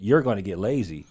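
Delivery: ordinary delivery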